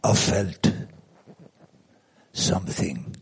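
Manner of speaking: put-on low, lightly French-accented, sinking at the end; a hint of a French accent, drawn out. Quoting friend Etienne, lightly French-accented. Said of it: "I said it in a weird French accent".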